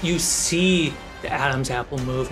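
normal talking voice

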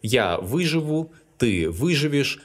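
Я выживу, ты выживешь with stress on вы; выживу, выживешь, endings left unstressed; first-syllable stress. Shown as a demonstration. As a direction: hit вы at the start of выживу and выживешь. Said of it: "stressed on the first syllable".

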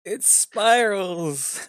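high, drawn-out excited announcement. Real, not acted. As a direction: stretch it out with delight. Excited the good player joined.

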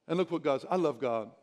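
ordinary talk, nothing marked

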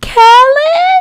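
high, loud stunned call of the name; shocked. Acted. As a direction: cry the name in disbelief. Calling out caught character.

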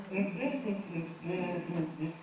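soft humming with lips shut; closed-mouth hums. Shown as a demonstration. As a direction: hum the rhythm with your mouth closed. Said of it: "口結んで".

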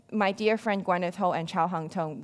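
ordinary talk

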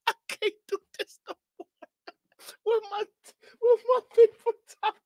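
high, squeaky words through uncontrollable laughing; laughing so hard he can barely get words out; helpless laughter. Real, not acted. Streamer losing it at a clip, laughing helplessly. Cracking up at the flex video.

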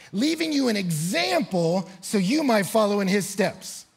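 ringing, emphatic Bible declamation; scripture preached forcefully. Real, not acted. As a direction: proclaim the verse with mounting force.

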